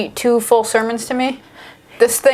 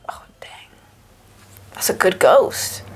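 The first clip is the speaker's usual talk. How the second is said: hushed mock awe; whispering; 5 semitones lower than usual. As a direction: whisper it like a gullible believer.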